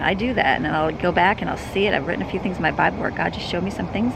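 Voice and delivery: ordinary talk, nothing marked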